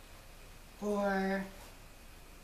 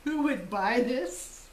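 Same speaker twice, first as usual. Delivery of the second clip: slow, drawn-out repeat rising at the end; stretched-out mock disbelief; mock-incredulous quote; slower than usual. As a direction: quote it back slowly as if you can't believe it. Repeats mother's remark in disbelief.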